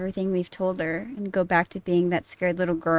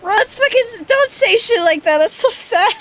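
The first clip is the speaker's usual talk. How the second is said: high, loud, choked-up outburst; real heartbroken yelling; emotional loud protest; 13 semitones higher, 8 dB louder than usual. Real, not acted. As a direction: rail against the sad scene. Upset at a character's farewell.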